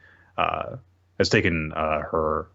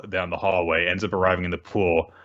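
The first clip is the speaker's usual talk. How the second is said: ordinary delivery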